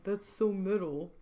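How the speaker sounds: slow, drawn-out mock fan voice; dragged-out teasing copy; lame mocking imitation. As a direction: mimic them to make it sound lame. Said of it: "You sound so lame saying".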